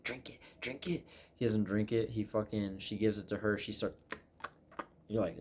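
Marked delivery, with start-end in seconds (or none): 0.0-1.1 soft imitation of a creepy witch lady; hushed, insistent witch impression; witch lady urging. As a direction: urge softly, as the witch lady. Acting witch lady urging him to drink.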